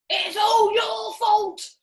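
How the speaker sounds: high, loud, slow furious yell. Acted. Mister Twit yells at his wife, blaming her. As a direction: hurl the blame at her. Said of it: "yelled Mister Twit".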